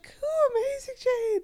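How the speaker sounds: very high-pitched fake delight; mock enthusiasm. Friend cheering on Jane's doubtful plan, mock-enthusiastic. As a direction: gush supportively, overdoing it. Acting out her fake supportive reply.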